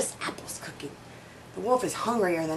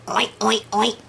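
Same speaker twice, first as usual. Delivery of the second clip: playful oinking pig noise; piggy oink. Acted. Reading to kids, the pig squeals oink oink.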